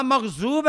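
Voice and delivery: مغضوب with z in place of ض; wrong z. Shown as a demonstration. Teacher rejecting the z reading, z in مغضوب. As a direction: say magh-zoob for مغضوب. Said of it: "کچھ زا سے بدلتے ہیں".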